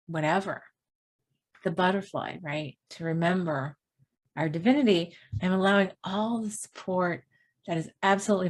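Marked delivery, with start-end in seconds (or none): none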